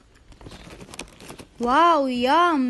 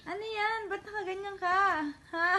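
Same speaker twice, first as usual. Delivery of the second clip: high, soft pet talk; 6 semitones higher, 7 dB quieter, faster than usual. Real, not acted. Owner cooing high questions at the pet.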